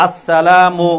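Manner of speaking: আসসালামু slow and loud, every syllable drawn out; clear, correct pronunciation. Shown as a demonstration. Teacher models the greeting for children to repeat, slow clear pronunciation. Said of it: "স্পষ্ট শুদ্ধ উচ্চারণে কি দিব".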